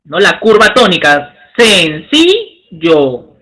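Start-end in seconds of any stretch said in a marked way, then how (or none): 1.6-3.3 sencillo drawn out slowly, leaning on CI; pronunciación lenta marcando sílabas; slow stress demo. Shown as a demonstration. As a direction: stretch the word to show the stress.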